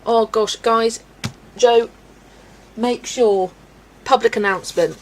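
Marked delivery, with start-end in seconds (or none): none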